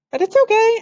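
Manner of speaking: high, loud hurried reassurance; big sister soothing a nervous brother; eager reassurance. Acted. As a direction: rush to calm him down. Reassuring scared little brother.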